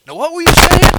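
high, loud challenge; loud demand. Retold or imitated. Gang leader turning back to the preacher, loud and high. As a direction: throw the question out full voice.

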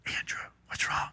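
soft unvoiced whisper of a worried friend. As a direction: lean in and whisper the question. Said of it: "I said".

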